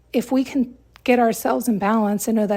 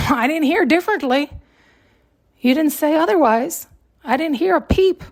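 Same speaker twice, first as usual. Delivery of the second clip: higher and louder defensive complaint; protest, voice raised and pitched up; mock indignant accusation, higher and louder; indignant retort; 4 semitones higher, 3 dB louder than usual. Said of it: "And you'll say".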